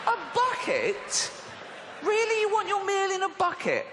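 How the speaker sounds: squeaky, appalled questioning; incredulous. Acted. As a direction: question the order in utter disbelief. Disbelieving reaction to bucket order.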